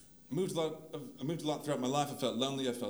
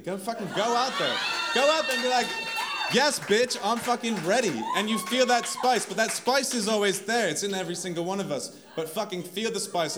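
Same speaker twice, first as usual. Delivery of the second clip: rallying cry; 4 semitones higher, 5 dB louder than usual. Real, not acted. Rallying crowd to feel spice.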